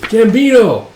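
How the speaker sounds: high, loud excited call. Acted. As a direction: holler the name on rushing in. Rushing back, calls out.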